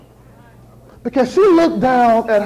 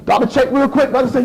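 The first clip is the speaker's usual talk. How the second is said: ordinary talk, nothing marked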